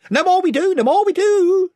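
loud, high chiming in; chorus of followers. A narrator's reading. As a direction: echo the chief in a high shout. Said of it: "chimed in the others".

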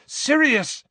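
Harry calling Sirius loudly. A narrator's reading. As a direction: call out to stop him. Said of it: "said Harry loudly".

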